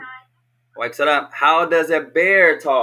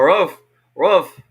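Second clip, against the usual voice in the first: dog bark. Shown as a demonstration. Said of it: "what sound does a dog make".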